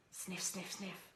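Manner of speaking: soft dog sniffs; sniffing. Acted.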